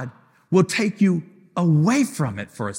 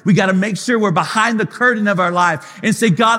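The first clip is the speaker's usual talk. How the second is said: preaching at full force; 3 semitones higher than usual. Acted. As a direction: drive it home like a revival climax. Preaching builds over the music.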